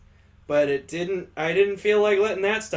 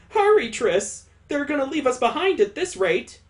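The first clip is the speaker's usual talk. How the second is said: higher-pitched urgent character voice; urgent racing character; 9 semitones higher than usual. Acted. Urging Triss to hurry before they're left behind, urgent. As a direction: plead higher, hurrying them along.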